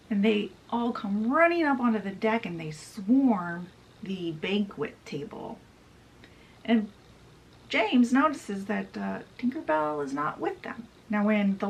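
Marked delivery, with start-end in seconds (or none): none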